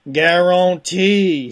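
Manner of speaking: loud, drawn-out Cajun catchphrase; "gar-on-TEE" stretched out in a Cajun accent. Acted. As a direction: belt it slowly, Cajun style, as the big finish. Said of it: "There's some Cajun".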